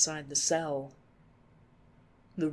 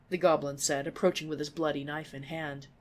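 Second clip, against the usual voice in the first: usual speaking voice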